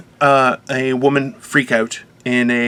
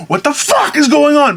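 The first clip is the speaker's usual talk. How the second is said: mock angry outburst; 10 semitones higher than usual. Big guy imagines losing it in a store, mock shout. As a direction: play the big guy losing his cool. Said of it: "stand there going".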